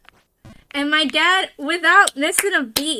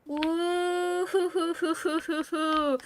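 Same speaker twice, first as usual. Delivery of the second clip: drawn-out woo breaking into short hoos; long woo-hoo hooting; 5 dB quieter, slower than usual. Real, not acted. Streamer idly filling time, soft woo-hoo hooting. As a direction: hoot softly in a string of hoos.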